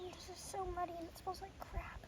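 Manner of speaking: whispered aside; whispering. Real, not acted. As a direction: whisper it so others miss it. Muddy trail, low aside.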